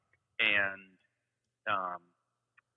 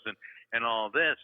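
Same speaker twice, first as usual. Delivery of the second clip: ordinary talk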